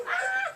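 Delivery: sharp high scream of fright; demo scream. Shown as a demonstration. Parent shows child how the heroine screams, high scream. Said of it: "Go, ... like that".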